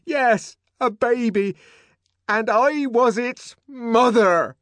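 high, loud, defiant cry; defiant challenge. Acted. Linda flinging her confession at the silent room. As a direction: throw it in their faces. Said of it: "like a challenge".

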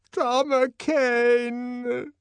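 very slow, high call of his name; drawn-out plea. Acted. Linda holding out her arms to the director, pleading. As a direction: reach out with the voice.

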